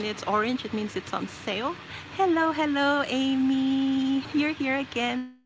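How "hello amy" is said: ordinary talk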